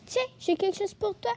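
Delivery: contented badger offering a present; very pleased. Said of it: "dit Théo le blaireau tout content".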